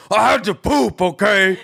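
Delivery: high, loud mock-furious outburst; angry adult male. Imitating an angry adult male for laughs, mock furious. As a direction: bellow it indignantly, hamming up the anger. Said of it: "an angry adult male to be like".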